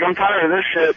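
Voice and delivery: ordinary delivery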